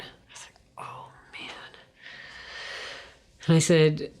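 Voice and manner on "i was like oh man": barely breathed oh man; whispered dread. Retold or imitated. Hearing the leader ask about her dad, whispered dread. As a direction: sigh it out under your breath.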